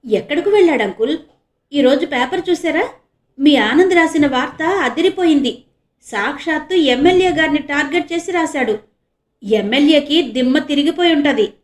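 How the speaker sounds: loud and bright, meant to be overheard; loud on purpose. A narrator's reading. Priyanka talks up the reporter so policemen overhear, loudly. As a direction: raise her voice so the constables hear. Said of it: "అంది ప్రియాంక గట్టిగా".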